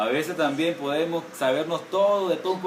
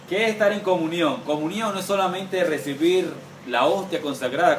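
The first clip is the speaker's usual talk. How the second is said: ordinary talk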